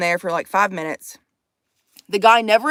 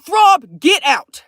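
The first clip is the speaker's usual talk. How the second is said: sharp order; 3 semitones higher, 6 dB louder, much slower than usual. Real, not acted. Orders Throb out.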